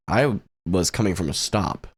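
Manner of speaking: normal talking voice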